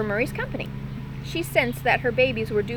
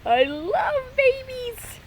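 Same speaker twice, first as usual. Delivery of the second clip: high, slow, beaming bat voice; delighted bat meeting the squirrel; grinning bat; 14 semitones higher, much slower than usual. Acted. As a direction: stretch it out with a big grin. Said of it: "He was grinning broadly".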